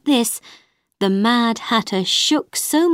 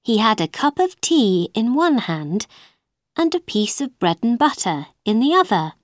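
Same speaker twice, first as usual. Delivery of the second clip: plain reading voice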